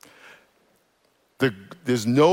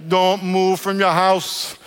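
slow command; 5 semitones higher, much slower than usual. Acted. Voicing Jesus' command, slow.

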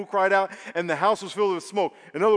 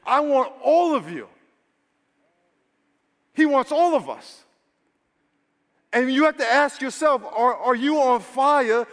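higher and louder, pleading preacher's call; impassioned preaching; 6 semitones higher, 4 dB louder than usual. Real, not acted. Pastor challenging the congregation, impassioned preaching. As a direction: press the crowd hard with passion. Preacher presses hard on the crowd.

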